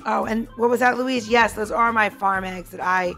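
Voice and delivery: usual speaking voice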